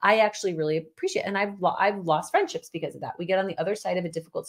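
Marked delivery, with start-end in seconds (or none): none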